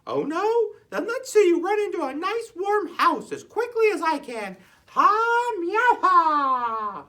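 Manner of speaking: high, loud meowing jeer; cat taunting Shelley; mocking cat.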